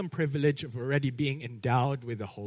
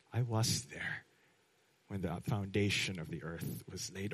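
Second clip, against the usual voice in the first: quiet voice of Jesus; 7 semitones lower, 9 dB quieter than usual. Retold or imitated. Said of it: "Jesus would say to that".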